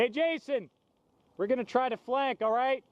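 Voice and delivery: calling out. Real, not acted. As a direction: call out to a distant teammate. Calling out to a teammate.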